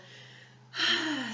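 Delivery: big audible sigh, high and falling; deep sigh. Shown as a demonstration. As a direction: let out a deep, releasing sigh. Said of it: "some deep sighs".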